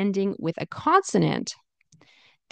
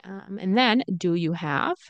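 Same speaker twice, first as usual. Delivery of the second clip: normal talking voice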